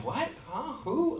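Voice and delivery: high-pitched, acted double take; startled little questions, played for laughs; taken by surprise. Dad suddenly recognized by a fan, acting caught off guard. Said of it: "it takes me by surprise".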